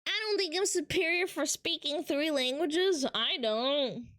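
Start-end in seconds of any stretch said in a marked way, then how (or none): none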